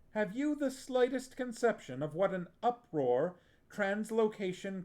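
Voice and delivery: put-on a sorceress rounding on a foolish boy in fury; blazing anger. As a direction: tear into him for his blunder. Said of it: "her eyes blazing".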